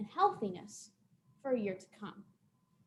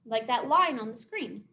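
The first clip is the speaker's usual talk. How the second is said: ordinary talk, nothing marked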